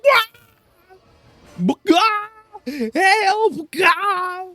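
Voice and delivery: loud, very high chicken squawk; frantic squawking cries for help. Acted. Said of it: "That was, uh, my [name] voice".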